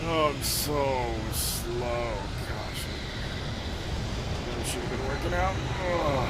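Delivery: urgent, breathless fleeing; panicked chase, out of breath; being chased. Real, not acted. Fleeing the killer in game.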